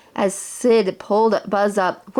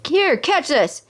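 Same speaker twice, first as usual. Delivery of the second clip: higher shouted call across the gap; 7 semitones higher, slower than usual. Acted. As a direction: yell it as you throw.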